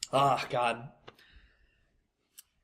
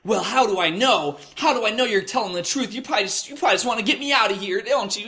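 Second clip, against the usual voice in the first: high mocking whiny voice of a suspicious woman; mocking suspicious customer; 7 semitones higher than usual. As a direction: mimic her distrust, pitched way up. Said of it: "women especially would be like".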